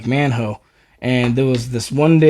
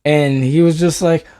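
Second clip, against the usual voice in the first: normal talking voice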